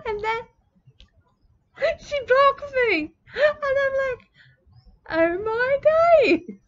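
high, slow, laughing; cracking up in disbelief. Real, not acted. Retelling how she was blocked, laughing.

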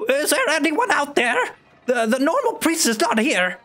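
muffled high voice from a room; through a wall. Acted. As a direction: call out from behind a door. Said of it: "a muffled voice".

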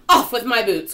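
loud, high exclamation; urgent cry. A narrator's reading. Centipede fears swimming in boots, urgent cry. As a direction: burst out with the order. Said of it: "cried the centipede".

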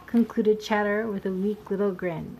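ordinary delivery

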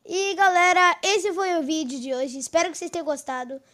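loud, upbeat channel sign-off; YouTuber outro. Acted. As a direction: pump up the outro for viewers.

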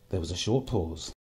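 ordinary delivery

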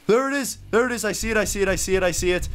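high, urgent repeated exclamations; excited spotting. Real, not acted. Player spots the flashlight at last, excited. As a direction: blurt it out the moment you spot it.

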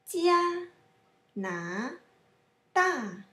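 加 high level, 拿 rising, 大 falling; tone drill. Shown as a demonstration. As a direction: separate 加 拿 大, each tone fully drawn. Teacher demonstrates tones syllable by syllable.

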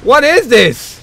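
high, loud outburst of frustration; exasperated yell. Real, not acted. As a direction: yell it at the game in disbelief. Frustrated by the game.

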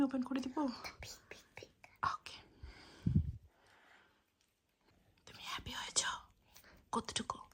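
soft unvoiced whisper; mother whispering while her son sleeps. Real, not acted. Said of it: "আমরা আস্তে আস্তে কথা বলছি".

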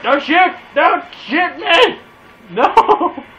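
loud, high, slow desperate pleading; panicked plea. Real, not acted. Gamer begs an enemy player not to shoot, loud and panicked. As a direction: beg for your life at full voice. Pleading while being attacked.

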